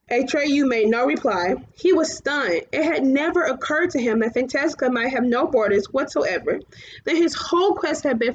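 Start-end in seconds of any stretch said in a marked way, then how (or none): none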